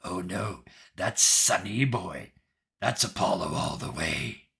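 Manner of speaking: slow, low hiss with drawn-out words; hissing patron. Acted. Patron hissing a realization to Phoebe, slow and low. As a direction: hiss it low, savoring each word. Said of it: "your patron, uh, hisses".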